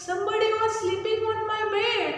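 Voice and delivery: a hint of a fussing mother bear; mama bear. A narrator's reading. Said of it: "Mama Bear also said".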